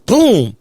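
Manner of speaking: loud mock slam, "Boom!"; a loud vocal boom; imitated door slam boom. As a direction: punch it out like a slamming car door. Car door slams, sound effect.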